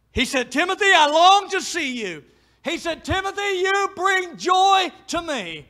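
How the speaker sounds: high, ringing pulpit voice; fervent preacher quoting scripture; impassioned preaching. Acted. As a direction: lift Paul's words up high.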